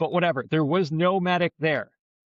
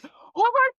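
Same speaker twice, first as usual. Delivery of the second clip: very high, slow panicked shriek; high mock shriek of a stung woman; much slower than usual. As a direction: shriek it in shock and pain.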